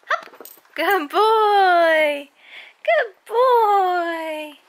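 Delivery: high, loud sing-song praise for the dog; doggy praise. Real, not acted. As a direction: gush over the good dog.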